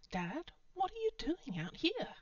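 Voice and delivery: put-on high, surprised teenage voice; teen girl voice. A narrator's reading. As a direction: voice a startled daughter.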